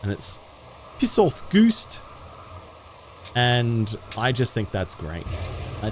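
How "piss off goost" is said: high mock New Zealand accent; imitated Kiwi accent. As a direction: quote the advert in a pitched-up Kiwi accent. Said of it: "a Kiwi advert from back in the day".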